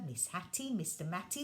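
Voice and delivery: plain reading voice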